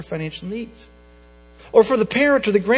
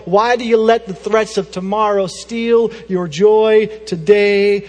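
louder, driving sermon questions; preaching at full force; 5 dB louder than usual. Real, not acted. Pastor to congregation, preaching louder and harder. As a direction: press the congregation hard, louder.

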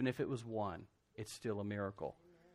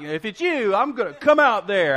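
high, loud, brash Peter voice; bold Peter; 9 semitones higher, 15 dB louder than usual. Retold or imitated. Preacher voices Peter shouting out to Jesus, brash and loud. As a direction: declare it like a daredevil. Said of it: "he's like".

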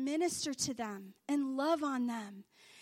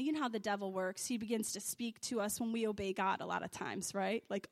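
ordinary delivery

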